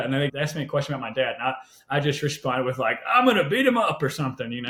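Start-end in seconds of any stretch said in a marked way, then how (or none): 3.1-4.0 tough-guy bravado, pitched high and a bit louder; mock macho threat. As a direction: play the cool macho guy with a half-joking threat. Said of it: "like, cool and macho at the time".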